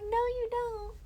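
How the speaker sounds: high, soft playful refusal; stubborn mom. Retold or imitated. Mom refusing to let son grow, high soft voice. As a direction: refuse him sweetly. Mom's reply.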